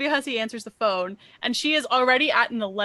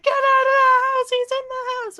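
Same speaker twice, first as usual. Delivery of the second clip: much higher, louder hammed-up warning shout; mock panicked alarm; 13 semitones higher, 6 dB louder than usual. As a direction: yell the warning like a frantic cop. The officer raising the alarm.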